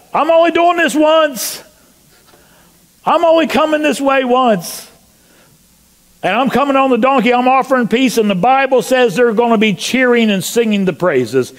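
high-pitched, impassioned proclamation; fervent preaching. Acted. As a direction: preach it out in the voice of Jesus. Preacher voices Jesus in rising fervour.

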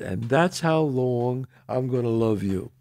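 low, soft and slow; retold love vow. Man proposing to his girlfriend, low tender sincerity. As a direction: let the promise land quietly, unhurried.

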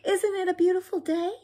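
very high, chirpy character voice; high-pitched sunny tumbleweed; cheery calling out. A narrator's reading. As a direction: gush in a high little voice. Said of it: "Rosie the tumbleweed called out".

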